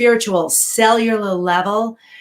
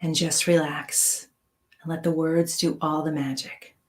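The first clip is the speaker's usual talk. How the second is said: guided meditation; 4 dB quieter than usual. Acted. Guides breathing and relaxation.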